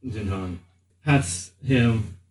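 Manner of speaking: ordinary talk, nothing marked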